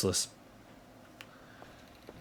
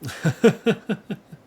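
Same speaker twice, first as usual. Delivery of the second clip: high, real burst of giggles; amused laugh; 10 semitones higher, 3 dB louder than usual. Real, not acted. Streamer amused by a Texan viewer hearing the accent, high laugh.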